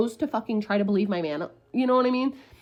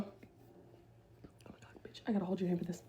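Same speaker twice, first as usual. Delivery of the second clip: very soft, breath-only whisper; hushed aside; 5 semitones lower, 16 dB quieter than usual. Retold or imitated. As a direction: whisper it as bad news. Mimicking messengers breaking bad news.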